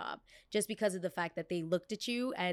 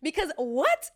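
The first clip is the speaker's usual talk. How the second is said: defiant glee; 4 semitones higher, 7 dB louder, faster than usual. Real, not acted. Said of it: "describing smiling harder".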